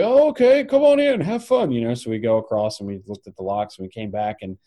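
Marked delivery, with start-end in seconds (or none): 0.0-1.7 high, louder cheerful wave-through; breezy border guard. Retold or imitated. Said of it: "he's like".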